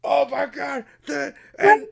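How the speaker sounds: high, slow squealing goblin cheer; excited goblin. Acted. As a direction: shriek it like a thrilled little goblin. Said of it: "the goblin up top being like".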